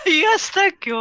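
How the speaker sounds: high, bright posh mock. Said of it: "You have to speak with that voice".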